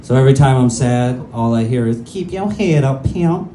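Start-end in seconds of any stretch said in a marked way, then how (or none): 2.1-3.5 high, bouncy Cat Williams voice; Cat Williams impression. Inner narrator cheering him when sad, in a high Cat Williams voice. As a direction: hype yourself up Katt Williams style, pitched up. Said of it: "narrated by Cat Williams".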